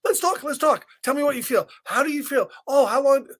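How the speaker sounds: very high, loud coaxing hen; coaxing chicken. Acted. Reader voicing the chicken coaxing Taylor. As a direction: squawk it out eagerly. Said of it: "was trying to be nice and was like".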